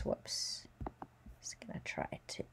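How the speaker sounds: under-the-breath whisper while writing; murmured whoops at the board; whispered aside. Real, not acted. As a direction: whisper it to yourself as you fix a mistake. Whispered while fixing whiteboard.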